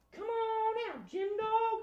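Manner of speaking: very high, soft echoing call; tiny falsetto voice calling out. Pretend. High echo of the group call.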